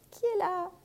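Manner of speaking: high, thin granny voice; old grandmother. Acted. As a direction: call out as a frail grandmother. Grand-mère répond. (voix de grand-mère fragile)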